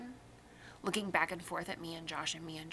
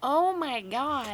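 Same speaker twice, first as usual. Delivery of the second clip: slow, drawn-out disbelief; stunned; 6 semitones higher, 5 dB louder, much slower than usual. Real, not acted. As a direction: let the shock sink in. Reacting to friend not watching.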